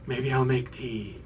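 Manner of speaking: low, soft, strange offer; oddly subdued. Retold or imitated. Muffy offering tea to the group, oddly subdued. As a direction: imitate someone being eerily understated. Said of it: "she's talking very strange".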